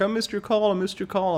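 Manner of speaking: high, half-hearted mock whimper; mock crying. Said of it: "people crying 'cause they're like".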